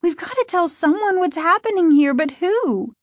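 put-on high, fast, frantic girl; panicked, desperate. A narrator's reading. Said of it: "panic and desperation in her voice".

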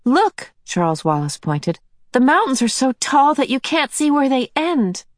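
put-on light, high boy's voice; a small boy pointing in wonder; young boy voice. A narrator's reading. Child Charles Wallace speaks.

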